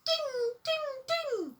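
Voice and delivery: very high, sung ding ding. A narrator's reading. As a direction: chime it like a tiny bell. The mystery bell sound.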